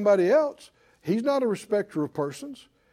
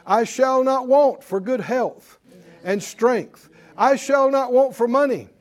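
preached confession; 4 semitones higher, 5 dB louder than usual. Acted. Declaring confessions to congregation.

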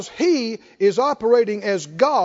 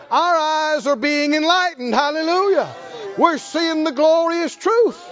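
full-force preaching; 3 semitones higher than usual. Acted.

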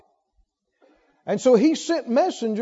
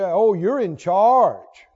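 slow, drawn-out sarcasm; mock someone's authority, stretching the words; sarcastic; 3 semitones lower, slower than usual. Needling someone who claims to be in charge, sarcastic.

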